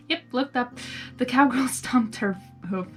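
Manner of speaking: ordinary delivery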